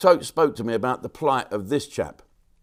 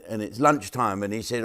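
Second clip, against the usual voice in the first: normal talking voice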